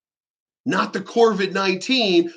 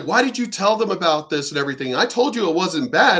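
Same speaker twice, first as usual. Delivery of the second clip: normal talking voice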